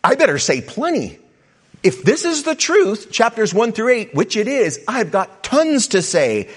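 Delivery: overflowing eager voice; bursting with praise. Retold or imitated. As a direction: sound like you could explode with thanks. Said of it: "could also be".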